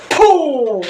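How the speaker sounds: a sharp puff of breath blown out; blowing puff. Real, not acted. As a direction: blow a quick burst of air as if casting out a spirit. Healer blowing after the spell.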